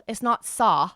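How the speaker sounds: "saw" said flat, like sah; mock the wrong "saw", no W; "saw" clipped to sah; flattened saw. Shown as a demonstration. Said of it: "you're not saying the W at all".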